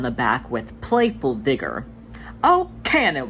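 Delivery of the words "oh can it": teasing brush-off. Acted. Tugger teasing brother.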